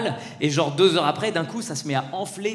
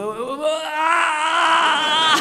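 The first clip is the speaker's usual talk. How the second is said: faked pain cry; 11 semitones higher, 4 dB louder, much slower than usual. Son faking pain.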